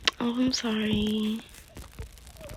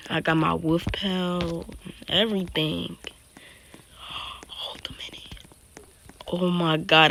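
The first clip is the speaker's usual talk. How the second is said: ordinary talk, nothing marked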